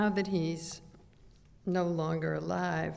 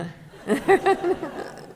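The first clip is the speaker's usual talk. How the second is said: laugh; 13 semitones higher, 8 dB louder than usual. Real, not acted. Reacts to the song.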